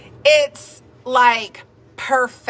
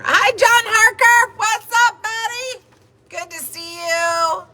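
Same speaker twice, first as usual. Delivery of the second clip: much higher, bright hello to a friend; excited greeting; 10 semitones higher than usual. Real, not acted. Excitedly greeting a viewer on livestream.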